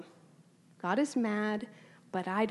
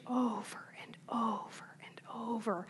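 whispered aside; 8 dB quieter than usual. Real, not acted. Aside about the long text.

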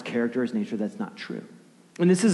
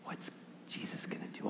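whispered wondering of an expectant crowd; very quiet, breath held; hushed anticipation; 19 dB quieter than usual. Acted. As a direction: whisper it with suspense. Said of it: "built-up anticipation in the room".

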